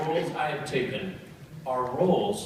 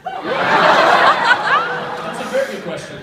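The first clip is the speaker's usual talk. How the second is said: slow amused laughing reply; 9 dB louder, much slower than usual. Real, not acted. Answering a small fan's question, slow and laughing. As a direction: chuckle through the words.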